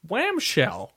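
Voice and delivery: put-on "Whamshell" high and falling, kid voice; the kid announces "Whamshell"; kid saying Whamshell. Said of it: "this kid is like".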